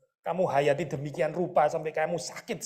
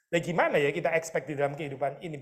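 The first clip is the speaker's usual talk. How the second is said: ordinary delivery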